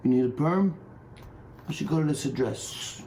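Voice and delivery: a hint of a hushed mob tout; lightly voiced secret salon dealer; shady mobster. Mob tout whispers a salon address to a customer, lightly voiced. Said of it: "Now they're like".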